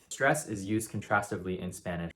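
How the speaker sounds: normal talking voice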